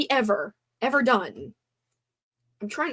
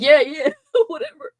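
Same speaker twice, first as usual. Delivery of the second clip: louder, higher, laughing brush-off; laughing dismissal; 6 semitones higher, 5 dB louder than usual. Retold or imitated. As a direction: laugh it away carelessly. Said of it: "and then I'm like".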